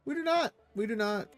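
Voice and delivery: squeaky high answer; high playful. Real, not acted. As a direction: pip it up high.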